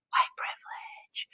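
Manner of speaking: hushed punchline; conspiratorial whispered aside. Acted.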